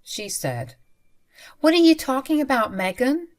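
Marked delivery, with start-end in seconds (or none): 1.6-3.3 quick, higher puzzled question; surprised. Retold or imitated. Mother-in-law puzzled by the frantic call, surprised. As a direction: sound genuinely confused. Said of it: "sounded super surprised".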